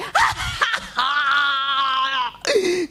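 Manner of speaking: long, high villainous cackle; triumphant cackle. Acted. Anansi traps rabbit as he says five, long gleeful cackle. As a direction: cackle at length, trap sprung. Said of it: "And then Nancy's like".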